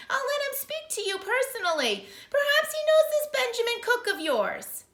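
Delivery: high, loud prim clerk; clerk on the phone. Acted. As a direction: sound like a fussy official.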